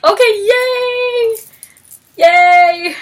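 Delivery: high, drawn-out happy yay; squealed celebration; gleeful cheer. Retold or imitated. Cheering on the characters.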